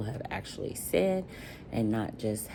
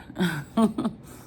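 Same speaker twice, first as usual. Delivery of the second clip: a light high giggle; real laugh; 7 semitones higher than usual. Real, not acted. Vlogger naming her favorite movie, light giggle. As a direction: giggle at your own answer.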